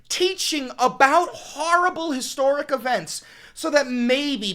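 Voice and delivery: loud, high and slowed, near breaking; emotional yelling. Real, not acted. Host yelling at his viewers about a hospital bombing, anguished. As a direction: cry out in real anguish at the audience. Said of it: "I'm sorry I'm yelling so much".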